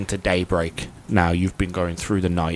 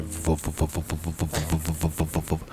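ship engine hum; 2 semitones lower, 8 dB quieter than usual. Acted. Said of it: "you hear a".

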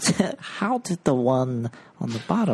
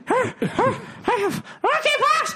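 high shriek. Pretend. Shrieked joke announcement.

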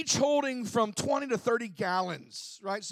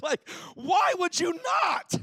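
high, slow incredulous shout; why would anyone refuse, make it big; excited; 8 semitones higher, slower than usual. Real, not acted. Pastor to congregation, raising his voice in disbelief. Incredulous about Nutty Bars.

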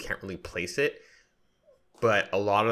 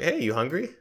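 caring friend asking; 8 semitones higher than usual. Retold or imitated. Friends ask if he's hungry.

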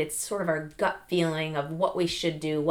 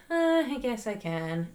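slow, hesitant mumble; half-hearted, doubtful counter; weak and unsure; 4 semitones higher than usual. Shown as a demonstration. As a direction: say it like you barely believe it. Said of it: "try to counter it with".

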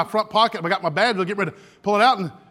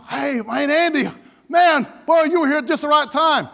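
loud, high hearty hail; excited greeting; 7 semitones higher, 4 dB louder than usual. Acted. Arriving cops to their colleague, excited greeting. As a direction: greet a buddy with big delight.

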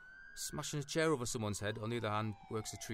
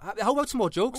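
nervous; 10 semitones higher, 13 dB louder than usual. Acted. Nervous interviewer changing subject.

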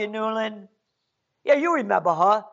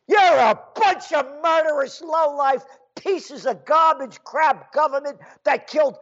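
higher, louder angry blast; furious tirade; 7 semitones higher, 3 dB louder than usual. Real, not acted. Host rails at the British Empire, furious and loud. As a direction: spit the insults out in fury.

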